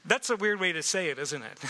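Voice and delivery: ordinary talk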